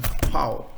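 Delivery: "Pfau" said as "fow", starting with f; German letter name. Shown as a demonstration. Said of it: "F is pronounced as".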